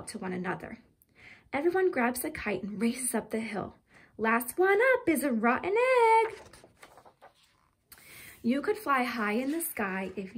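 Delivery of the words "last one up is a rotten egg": louder, higher teasing race challenge; bright schoolyard dare; playful call. A narrator's reading. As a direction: call it out as kids race uphill. Kids racing up the hill.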